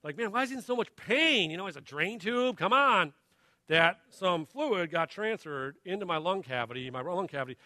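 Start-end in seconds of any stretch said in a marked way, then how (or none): 0.2-3.1 higher-pitched, scoffing impatience; mock exasperated bystander. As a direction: imitate people dismissing a wimp. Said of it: "people just thought I was a wimp".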